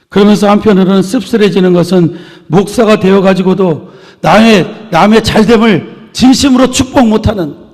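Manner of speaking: forceful preaching. Real, not acted. Pastor lamenting ministers who cannot bless others, forceful preaching. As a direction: press each phrase home from the pulpit.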